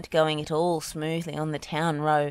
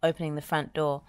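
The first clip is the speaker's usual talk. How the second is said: ordinary delivery, nothing marked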